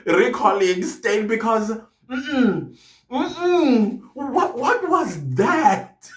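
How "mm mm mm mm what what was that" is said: higher, slow incredulous hum and question; drawn-out what-was-that disbelief; mock disbelief. Reacting to the testimony with slow, high mock disbelief.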